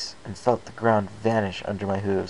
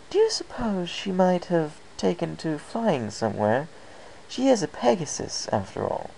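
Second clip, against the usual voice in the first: higher, lightly refined lady suggesting an idea; a hint of Rarity; 10 semitones higher than usual. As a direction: elegant mare gently proposing. Rarity voiced suggesting flying.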